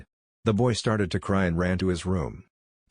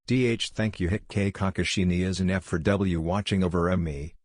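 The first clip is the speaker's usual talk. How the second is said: ordinary delivery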